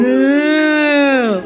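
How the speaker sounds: low, loud mooing like a cow. Shown as a demonstration. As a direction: moo for the kids like a cow. Teacher models the cow sound.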